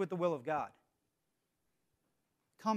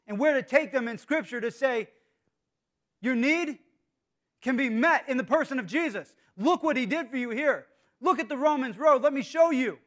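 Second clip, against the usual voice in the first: raised, louder evangelistic appeal; fervent preaching; 6 semitones higher, 6 dB louder than usual. Acted. Preacher showing church how to share Jesus, fervent. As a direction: press each phrase home with force.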